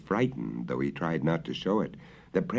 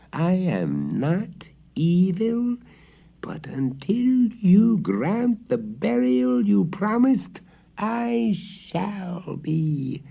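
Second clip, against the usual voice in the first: high, very slow eerie ghost voice; ghostly threat; 12 semitones higher, 4 dB louder, much slower than usual. A narrator's reading. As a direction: drag out the threat menacingly.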